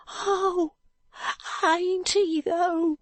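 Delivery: high-voiced boy, doubtful; a ragged boy contradicting the doctor; street boy. Joe disputes Alan.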